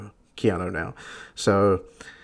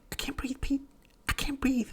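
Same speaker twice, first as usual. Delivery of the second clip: tiny high, faint gasping voice; mock dying aunt, squeezing out soft falsetto words; Aunt May; 10 dB quieter than usual. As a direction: feeble high-pitched wheeze, hammed up. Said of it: "she's like".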